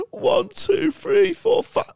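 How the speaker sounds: very high, crying wail to the police; tearful Mike. Retold or imitated. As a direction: sob it out high and broken. Mike reads number, tearful.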